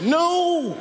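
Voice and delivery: sharp high shouted no; group shout. Retold or imitated. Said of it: "And everyone said".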